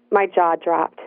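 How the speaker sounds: plain reading voice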